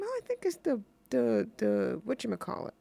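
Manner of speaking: usual speaking voice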